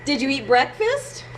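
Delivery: ordinary talk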